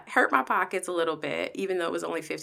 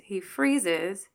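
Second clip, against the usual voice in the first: ordinary talk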